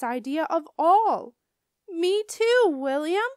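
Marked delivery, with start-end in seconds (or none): 1.9-3.3 loud, high-pitched eager holler; excited holler. A narrator's reading. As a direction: holler it out like a thrilled kid. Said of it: "I hollered".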